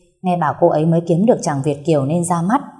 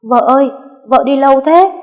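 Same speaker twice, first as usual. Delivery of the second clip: high, loud call to his wife; loud shout; 8 semitones higher, 8 dB louder than usual. A narrator's reading. Husband surprising his wife at the café, shouting loudly. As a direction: shout out from behind her, pitched high. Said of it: "hét to".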